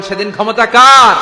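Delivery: shouted full out, ending in a fall; loud shout. Acted. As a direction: bellow the question to the crowd. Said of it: "আওয়াজ করে বলেন".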